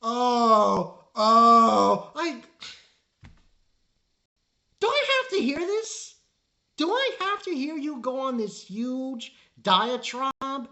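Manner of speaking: high, whiny crying mock of the villain. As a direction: whine like a self-pitying villain. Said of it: "He continues".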